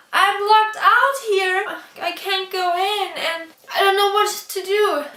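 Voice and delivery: put-on a locked-out child begging a neighbour; panicked plea. Crying child calls for help.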